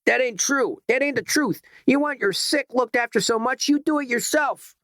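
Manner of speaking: very high voiced yell of an indignant striker; picket yelling back. A narrator's reading. Striking picket yelling back at the woman, high indignant yell. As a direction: fling it back at her, high and defiant. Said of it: "The picket was yelling back".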